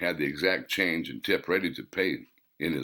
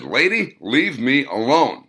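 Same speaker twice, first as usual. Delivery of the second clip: louder, firm and slow; irritated warning; 5 semitones higher, 6 dB louder than usual. A narrator's reading. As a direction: warn her off, fed up. Duke fends off the rude woman.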